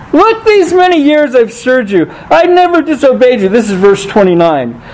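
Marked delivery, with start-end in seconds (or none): 0.1-3.5 put-on high, aggrieved older brother pleading his record; high-pitched wounded older brother, stressing never; resentful older son. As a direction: whine it like the dutiful son who feels cheated. Older son complains to father.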